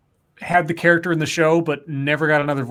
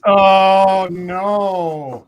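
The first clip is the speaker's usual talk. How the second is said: loud, drawn-out "oh no"; mock dismay; 3 semitones higher, 6 dB louder than usual. Real, not acted. Reacting to an outrageous casting idea, in drawn-out dismay. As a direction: groan it out long, falling away at the end.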